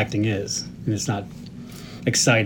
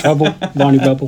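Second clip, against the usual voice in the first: mock Cockney rhyming slang; terrible British accent; 3 semitones higher, 4 dB louder than usual. Co-host joining in the teasing, hammed-up bad British accent. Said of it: "your terrible British accent".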